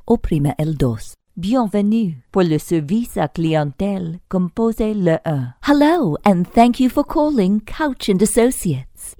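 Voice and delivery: usual speaking voice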